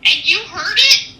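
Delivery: high, loud wide-eyed question; amazed teenage girl. Retold or imitated. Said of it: "And the teenager goes".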